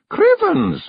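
a pixie's startled oath; surprised exclamation. A narrator's reading. Rob Anybody stares at the china, startled yelp.

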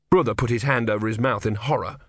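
ordinary delivery, nothing marked

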